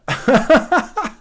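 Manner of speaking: a sudden high laugh breaking out; high burst of laughter. Real, not acted. Streamer laughs at his old professor's verdict, loud high laugh. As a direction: laugh out loud at the absurd quote.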